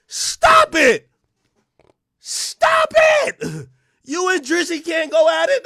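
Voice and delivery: squeaky high-pitched stop it; high squeaky teasing voice; mock high voice. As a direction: shriek it like a mock scold. Mocking high voice at LeBron.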